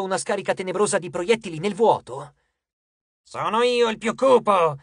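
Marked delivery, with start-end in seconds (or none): 3.2-4.8 slow, higher, louder demon boast; villainous one-upmanship; hissing demon. A narrator's reading. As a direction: stretch it out like a rival demon. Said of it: "sibilò uno dei demoni".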